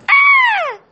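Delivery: piercing high scream; loud scream. A narrator's reading. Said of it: "He heard a loud scream".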